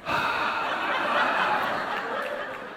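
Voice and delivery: long, breathy sigh, acted out; exasperated sigh. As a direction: let out a drawn-out fed-up exhale. Acting out having a hard time.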